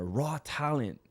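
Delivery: ordinary delivery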